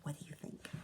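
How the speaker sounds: unvoiced suspense whisper. Real, not acted. Asking the children to guess, whispered. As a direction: hush them to guess.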